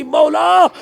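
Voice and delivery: loud high cry to God; crying prayer. Acted. Mother cries out to God in prayer, loud and high. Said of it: "عرض کرنے لگی".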